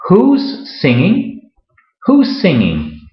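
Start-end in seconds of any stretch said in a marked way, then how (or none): 0.1-1.5 slow, careful, higher question with rising end; slow model question. Shown as a demonstration. Teacher modelling the contraction for a Spanish-speaking learner, slow and clear. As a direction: say it slowly so the learner hears the contraction. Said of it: "se puede contraer".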